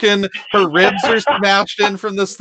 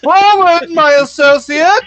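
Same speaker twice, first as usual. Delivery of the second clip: much higher, louder gallant horse voice; noble horse; 9 semitones higher, 4 dB louder than usual. Acted. Betsy the horse pledging to fight beside them, high gallant voice. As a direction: proclaim it like a loyal steed.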